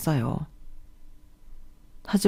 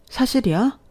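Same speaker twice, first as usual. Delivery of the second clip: shocked disbelief; 7 semitones higher than usual. Acted. Shocked by father's cancer news.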